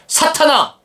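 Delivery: high, sharp rebuke; stern shout. Pretend. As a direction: bark the name at him. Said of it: "이렇게 소리를 또 지르십니다". (베드로를 꾸짖는 예수님, 높은 호통)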